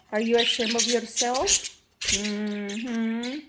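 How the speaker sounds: ordinary talk, nothing marked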